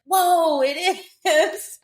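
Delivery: high, loud amazed "whoa" with a laugh; imitating a friend's amazed gasp; surprised gasp. Friend realising the lake is from the fight scene, amazed and laughing. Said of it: "She was like".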